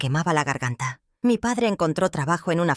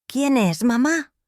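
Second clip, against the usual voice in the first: put-on higher, a little louder little girl voice; a small child asking, a bit startled; child's startled question; 8 semitones higher, 3 dB louder, slower than usual. A narrator's reading. Girl wakes, finds her mother crying, asks gently high. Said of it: "pregunté".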